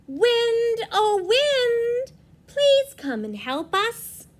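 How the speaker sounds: high, loud pleading call; calling the wind. Acted. Orange Maple calling the wind, high and loud.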